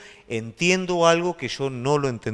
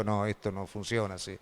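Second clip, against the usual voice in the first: Paul objecting; 7 semitones lower, 13 dB quieter than usual. Retold or imitated.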